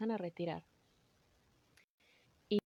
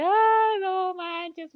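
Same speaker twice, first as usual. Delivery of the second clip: high, very loud tearful outcry; a shrieking, weeping "no way"; overwhelmed cry; 10 semitones higher, 11 dB louder, much slower than usual. Retold or imitated. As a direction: burst out crying in disbelief. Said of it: "las dos así de".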